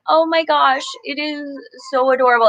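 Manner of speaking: normal talking voice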